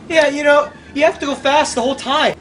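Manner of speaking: ordinary delivery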